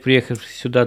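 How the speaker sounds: ordinary talk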